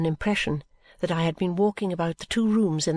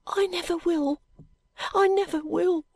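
put-on much higher girlish vow; earnest promise; 10 semitones higher than usual. Young maid vowing to her dying mistress, high earnest voice. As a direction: swear it fervently. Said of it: "she promised me".

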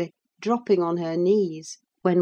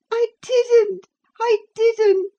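put-on high, heartbroken girlish wail; sobbing; 5 dB louder, slower than usual. A narrator's reading. Cathy denying it to Ellen, sobbing. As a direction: sob it out high and loud. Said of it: "sobbed Cathy, fit to break her heart".

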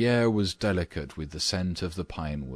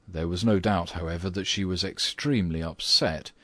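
usual speaking voice

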